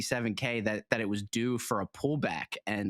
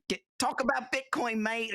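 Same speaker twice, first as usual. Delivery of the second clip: higher mock Australian accent urging back to Bitcoin; hammed-up Australian accent, pitched up; 10 semitones higher than usual. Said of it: "what would an Australian trying to get us back on topic sound like".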